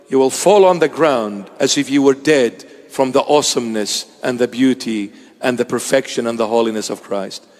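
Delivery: usual speaking voice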